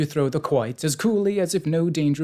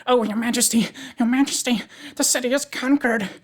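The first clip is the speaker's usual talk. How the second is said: high, urgent gasped report; gasped, out of breath; 11 semitones higher, 4 dB louder than usual. A narrator's reading. Soldier gasping news to His Majesty, out of breath. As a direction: gasp it out high and loud. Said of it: "gasped the royal army, who was all out of breath".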